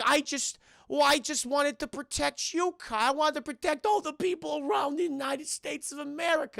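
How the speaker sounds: a worried mother begging her son; Kyle's mom. Retold or imitated. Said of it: "And Kyle's mom's like".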